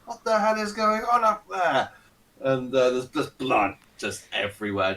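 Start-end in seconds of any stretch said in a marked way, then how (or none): 0.1-1.9 high, alarmed guard calling up; a guard shouting up in alarm; guard hearing the horse shriek, alarmed call; startled guard. Acted. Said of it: "He's like".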